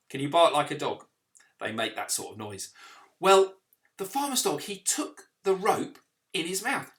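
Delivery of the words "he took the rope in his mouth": usual speaking voice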